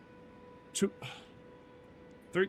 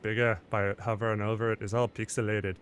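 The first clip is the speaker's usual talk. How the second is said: emphatic Scottish-style put-on accent; 5 dB louder than usual. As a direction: jump back into a playful Scottish-style accent. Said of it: "we're back in the voice".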